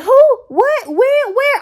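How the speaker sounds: squeaky high who what when where; hammed-up bewildered squeals; mock confusion.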